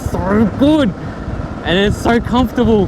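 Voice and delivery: high, loud delighted exclaim; excited. Real, not acted. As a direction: gush with joy. Thrilled on first ride.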